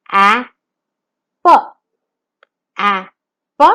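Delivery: letter by letter, spaced; sounding out words. Shown as a demonstration. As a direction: sound out A, P separately. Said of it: "let's sound out the letters".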